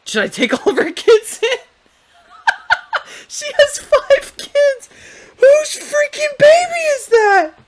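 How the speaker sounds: very high, loud, slow, cracking with laughter; laughing shriek. Real, not acted. Gamer discovers the five kids, shrieking laughter. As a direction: squeal in shocked laughter.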